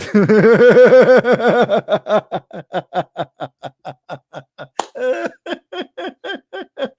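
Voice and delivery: long helpless high-pitched laughing fit; real breathless laughing fit; long loud laugh. Real, not acted. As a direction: laugh hard and long, almost crying. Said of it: "my backbone hurt from laughing".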